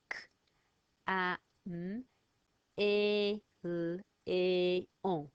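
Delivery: "k, a, m, e, l, e, on" split into separate loud French sounds; French phonics sounded out slowly; sounding out caméléon. Shown as a demonstration. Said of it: "those are all in the word for chameleon".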